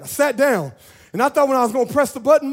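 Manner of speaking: normal talking voice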